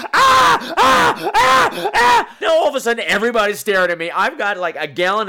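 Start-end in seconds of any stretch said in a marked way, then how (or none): 0.1-2.4 high, loud panicked shrieks; imitating a friend's frantic shrieking; repeated shrieks. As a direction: shriek over and over in alarm. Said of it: "She's like".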